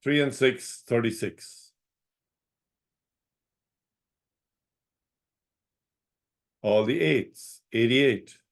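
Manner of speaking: calling each number out, then repeating it; sing-song bingo number calls; bingo caller. Acted.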